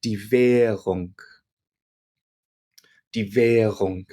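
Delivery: "Währung" with the "r" barely heard, just bridging the vowels; soft German R. Shown as a demonstration. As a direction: let the "r" in "Währung" melt away. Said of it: "very soft to the point where you almost don't hear it".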